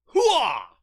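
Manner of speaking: full-throated hooah, high and loud; shouted battle cry. Shown as a demonstration. Vlogger showing viewers the real battle cry, loud shout. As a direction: bark it out like a soldier on the line. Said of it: "the battle cry itself".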